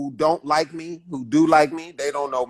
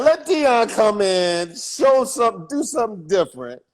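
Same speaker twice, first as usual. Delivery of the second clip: higher, louder hammed-up promoter pitch; excited promoter; 7 semitones higher, 5 dB louder than usual. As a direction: pitch the idea like an eager promoter. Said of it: "they would be like".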